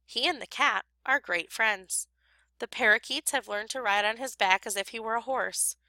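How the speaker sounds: ordinary delivery, nothing marked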